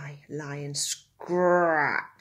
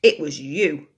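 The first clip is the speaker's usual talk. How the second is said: snorting accusation; 10 dB louder than usual. A narrator's reading. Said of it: "Aardvark snorted at Mongoose".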